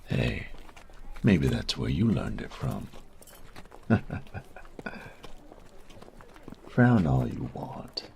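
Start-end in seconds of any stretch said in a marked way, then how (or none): 3.4-6.6 high, breathy mocking laugh; a teasing giggle that rises at the end. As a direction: laugh at them, delighted with the dig. Teasing the listener after a jab.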